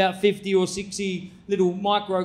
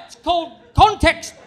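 usual speaking voice

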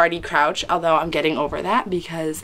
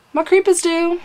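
high squeaky; 11 semitones higher, 3 dB louder than usual. Real, not acted. Jokey squeal about viewers.

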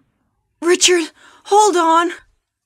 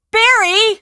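calling out; 5 semitones higher, 5 dB louder than usual. Acted. Calling for Barry after rope mishap.

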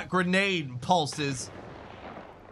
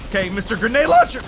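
voice cracking; 7 semitones higher, 9 dB louder, faster than usual. Real, not acted. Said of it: "Did my voice just crack?".

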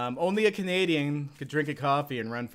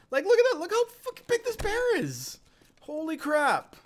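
astonished outburst. Real, not acted. Astonished at the huge bear.

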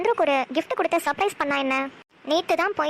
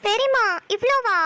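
high, drawn-out incredulous question; dismayed protest; 7 semitones higher, 3 dB louder, slower than usual. Acted. Niece shown too many flowers by aunt, dismayed. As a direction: whine in disbelief, pitch up high.

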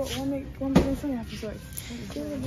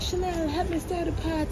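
higher-pitched mock plea for help; acted call for help; 8 semitones higher than usual. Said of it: "But I'm like".

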